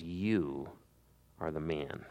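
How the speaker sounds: low, soft and hushed, pausing after You; a hushed, grave verdict; hushed accusation. Acted. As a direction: lay the charge on him quietly. Prophet confronts the king.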